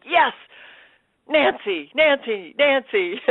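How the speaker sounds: re-enacted triumphant anticipation, building; eager excitement. Actor expects the lead from phone call, eager excitement.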